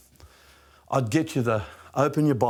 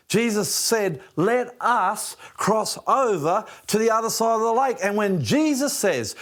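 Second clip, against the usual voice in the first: raised, fervent preaching, higher pitched; preaching at full force; 7 semitones higher than usual. Acted. Pastor urging his listeners to believe, preaching fervently. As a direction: drive the promise home to the crowd.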